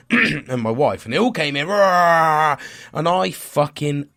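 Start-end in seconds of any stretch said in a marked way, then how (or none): 1.7-2.5 loud roar of a noisy family; imitating the family's loud chaotic entrance; mock roar. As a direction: roar like the whole pack barging in. Family storming in noisily.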